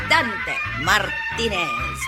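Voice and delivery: ordinary talk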